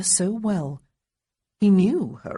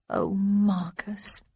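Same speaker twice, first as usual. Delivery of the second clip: tender whisper; 3 semitones higher, much slower than usual. A narrator's reading. Honoria to sick Marcus, tender whisper. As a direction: whisper his name with fond worry.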